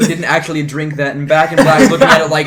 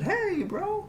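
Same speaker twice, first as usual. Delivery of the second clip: much higher, casual hey bro; high bro greeting; 11 dB quieter than usual. Retold or imitated. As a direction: toss off a high, chummy greeting. Player quoting his own greeting.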